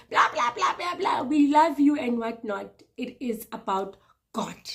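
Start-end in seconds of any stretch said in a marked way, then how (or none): 0.1-2.0 high mock blah-blah; mocking platitudes. Mocking people who keep saying we love you. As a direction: parrot their empty words sing-song. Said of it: "coming here and saying".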